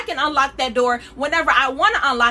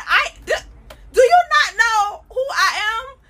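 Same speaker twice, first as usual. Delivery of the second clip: upset angel; 9 semitones higher, 4 dB louder than usual. Retold or imitated. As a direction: shout "do you not know who I am" in offended disbelief. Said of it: "Gabriel got a little upset".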